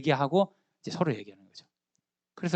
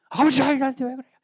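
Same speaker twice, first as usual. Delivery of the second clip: put-on higher, louder whiny protest like a teenager; sulky teen outburst; 9 semitones higher, 5 dB louder than usual. Jonathan snapping at his father Saul like a moody teenager. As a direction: fire it back at dad like a sulking adolescent. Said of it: "요나단도 화를 막 내는 거예요".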